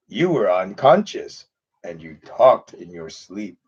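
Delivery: low, slow, gentle dragon rumble; Falcor's voice. Retold or imitated. Streamer does the luck dragon for Second Life viewers, low slow rumble. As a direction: speak like a big kindly dragon. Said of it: "when you're doing Falcor's voice".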